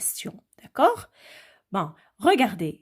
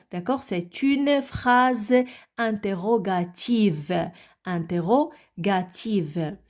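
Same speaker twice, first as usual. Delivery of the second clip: slow, careful repeated term; slow teaching emphasis; slower than usual. Shown as a demonstration. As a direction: spell the term out slowly. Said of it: "ouvrez bien vos oreilles".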